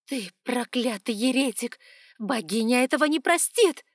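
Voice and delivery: damn him with rage; furious curse. Acted. Priestess curses archon.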